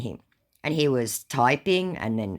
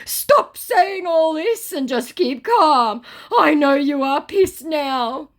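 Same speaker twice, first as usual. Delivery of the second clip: high, loud, slow put-on scammer voice; mock scammer voice; 7 dB louder, slower than usual. Scammer telling the narrator to calm down, high mock voice. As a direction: soothe him, condescending. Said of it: "said my scammer".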